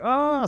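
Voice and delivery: high-pitched mock wail of dismay; hammed-up anguished oh. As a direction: let out a high, pained "oh". Humiliated rookie reacts, crying inside.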